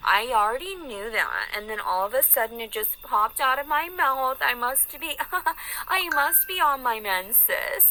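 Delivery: slow, drawn-out sarcastic confession; mock apology. Woman mocking those who call her crazy, exaggerated giggly apology. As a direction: play it falsely contrite, rising high with fake giggles.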